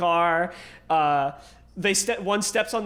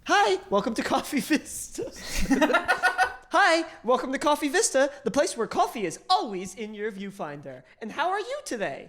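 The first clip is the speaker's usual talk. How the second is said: chirpy barista voice; 6 semitones higher than usual. Acted. Barista greeting repeated.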